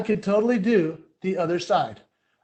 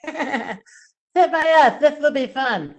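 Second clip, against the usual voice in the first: put-on high, loud, drawn-out kid voice; slow mock-excited schoolkid, pitched up; kid on bus; 6 semitones higher, 5 dB louder, much slower than usual. Kid on the bus inviting him over, high loud imitated voice. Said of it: "And he was like".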